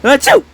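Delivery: loud high sneeze. Acted. Sick toy sneezing on another contestant. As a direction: sneeze hard and sudden. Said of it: "I'm sick".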